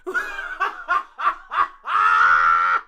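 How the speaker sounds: loud, high cackling burst; big excited laughing fit; loud laughter. Real, not acted. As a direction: laugh uproariously, ending in a long loud cry. Overcome by the song drop.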